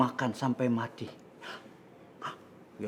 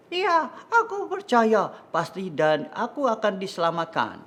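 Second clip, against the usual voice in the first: Daniel's confident reply; 8 semitones higher than usual. Acted. Narrator acts Daniel's answer to king.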